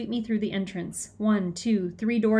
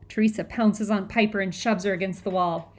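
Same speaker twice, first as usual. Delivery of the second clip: plain reading voice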